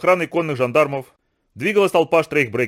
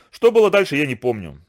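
ordinary delivery

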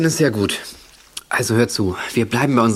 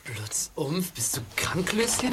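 whisper; 5 semitones higher, 8 dB quieter, slower than usual. Acted. Echoes the silly calls.

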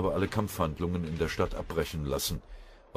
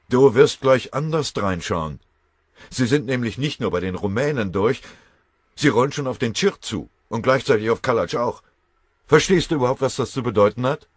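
laut, höher, wütend eindringlich; böse erregt; 7 semitones higher, 11 dB louder than usual. A narrator's reading. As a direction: dem Spötter die Lage wütend einhämmern. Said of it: "Fröbe funkelte den Spötter böse an". (Fröbe fährt den spottenden Ettlacher wütend und laut an)

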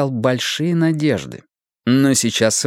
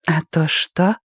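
curious flirty woman; 6 semitones higher, slower than usual. A narrator's reading. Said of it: "заинтересовалась та".

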